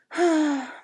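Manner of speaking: loud drawn-out sad sigh; heavy sigh. Acted. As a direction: sigh out the sadness. Preacher tasting sad candy.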